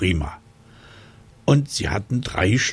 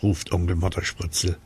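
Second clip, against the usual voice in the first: ordinary delivery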